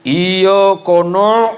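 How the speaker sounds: Iya kono dragged out slow and loud; a student's failed attempt; botched recitation. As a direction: blurt the mangled verse like a struggling child.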